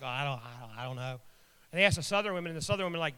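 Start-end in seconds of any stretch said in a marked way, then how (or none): 0.0-1.2 low, soft, faltering reply; mock wishy-washy hesitation; hesitant. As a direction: imitate someone unsure and stalling. Imitating hesitant Northern women.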